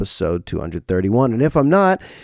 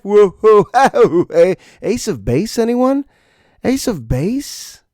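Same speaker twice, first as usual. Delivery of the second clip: excited callout; 9 semitones higher, slower than usual. Real, not acted. Excited reference call.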